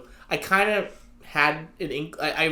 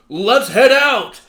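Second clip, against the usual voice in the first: high, loud, drawn-out hero shout; big cartoon hero send-off; mock heroic call; 6 semitones higher, 9 dB louder, much slower than usual. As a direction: call out a rallying cry, slowly. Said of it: "then he's like".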